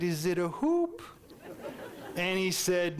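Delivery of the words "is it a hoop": put-on hopeful child guess. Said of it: "And I said".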